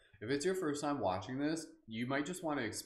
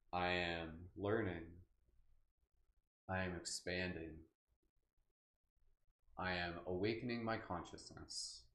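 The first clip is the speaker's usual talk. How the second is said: slow, low meditative affirmations with long pauses; calm, measured self-affirmation; 4 semitones lower, 4 dB quieter, slower than usual. Shown as a demonstration. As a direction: recite each one unhurried and soft, letting it settle. Leading spoken affirmations to viewers.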